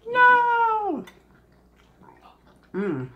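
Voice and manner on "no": high loud shriek of "No!". Retold or imitated. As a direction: yelp it out sharp and high. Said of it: "I would've been like".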